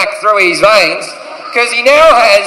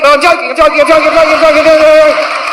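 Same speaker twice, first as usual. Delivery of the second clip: hurried backpedal; 6 semitones higher, faster than usual. Retold or imitated. Backpedalling after a bad joke.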